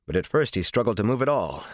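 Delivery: ordinary delivery, nothing marked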